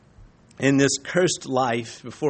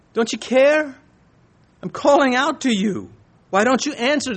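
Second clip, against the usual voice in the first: pleading prophet; 9 semitones higher than usual. Acted. Voicing Habakkuk's plea to God.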